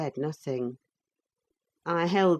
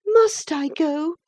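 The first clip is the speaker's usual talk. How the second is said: high, slow mournful question; high, slow doleful girl; 12 semitones higher, 6 dB louder, slower than usual. A narrator's reading. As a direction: ask it sadly. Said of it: "asked Catherine dolefully".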